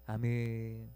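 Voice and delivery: ordinary delivery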